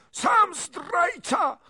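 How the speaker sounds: very high, drawn-out furious screech; shrieking noblewoman's retort; princess's scream. A narrator's reading. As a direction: scream the accusation back. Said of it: "krzyknęła kniachini".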